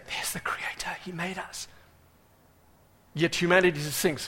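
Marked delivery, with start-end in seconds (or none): 0.1-1.7 hushed insect voices whispering; tiny awed whisper. Acted. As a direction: whisper it like a small creature in wonder. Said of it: "Every insect is saying".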